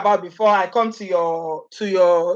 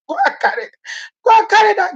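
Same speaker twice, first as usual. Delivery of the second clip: scolding; 11 semitones higher, faster than usual. Acted. Telling the man off.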